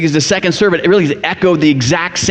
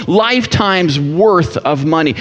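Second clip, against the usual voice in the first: ordinary talk, nothing marked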